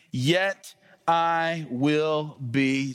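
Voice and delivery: very slow, word by word; slow emphatic reading. Real, not acted. Preacher reading the Psalm to congregation, slow suspenseful build. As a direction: draw out each word toward the key one.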